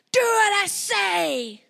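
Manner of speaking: slow, loud, high barked order; aggressive shout. Shown as a demonstration. Parent showing the aggressive style, slow loud demo shout. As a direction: bark it like an angry parent. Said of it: "aggressive, shouting, being angry".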